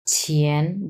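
ছিয়েন with a rising Mandarin second tone. Shown as a demonstration. Teacher models ছিয়েন for students, rising tone. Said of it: "যেহেতু দুই number tone".

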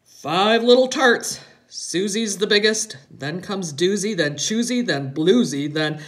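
lightly voiced father reciting a nursery game; playful father. A narrator's reading. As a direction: count off the names loudly, building up to the last. Father recites toe rhyme.

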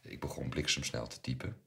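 ordinary delivery

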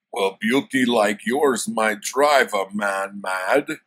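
loud, slow, hammed-up barbarian hero; Conan voice. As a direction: play the smooth-talking brute, slowly and loudly. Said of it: "why my Conan voice sounds like that".

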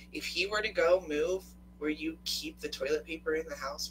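usual speaking voice

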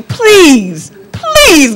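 high begging please; mock desperate plea; pleading prayer. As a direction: beg God, voice rising high. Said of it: "I would cry".

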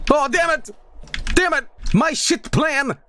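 high-pitched outburst of frustration; frustrated yelling. Real, not acted. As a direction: cry out high as the plan falls apart. Plan goes wrong in game.

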